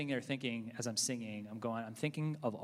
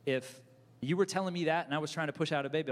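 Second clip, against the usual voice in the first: ordinary talk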